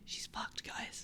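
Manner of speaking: whispered aside. Pretend. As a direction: whisper it to chat. Mock whisper aside to chat.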